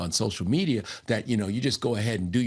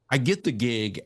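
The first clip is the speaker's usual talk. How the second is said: ordinary delivery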